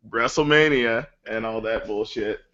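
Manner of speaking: ordinary delivery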